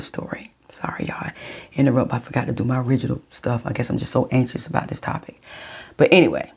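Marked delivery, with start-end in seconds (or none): 0.0-1.3 whisper. Real, not acted. Aside apologising to viewers.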